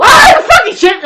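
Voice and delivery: sudden high scream of pain; acted shriek and curse; pained outburst. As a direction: yell as if your foot got stepped on. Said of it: "if you step on our foot".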